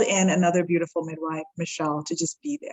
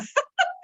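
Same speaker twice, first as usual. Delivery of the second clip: a sudden high laugh breaking into tears; tearful high laugh. Retold or imitated. Overwhelmed mother in labor refusing the tub, tearful laugh. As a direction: laugh it out high, close to crying.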